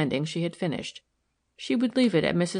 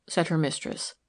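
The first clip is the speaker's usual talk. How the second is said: ordinary delivery, nothing marked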